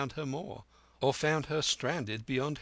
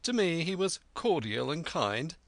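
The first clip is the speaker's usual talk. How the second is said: ordinary delivery, nothing marked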